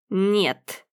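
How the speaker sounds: a soft, reluctant no; quiet. A narrator's reading. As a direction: answer barely above a murmur. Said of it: "тихо ответила Горгулья".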